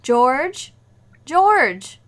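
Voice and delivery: loud call through the house. Acted. Wife calls for her husband at home, calling out.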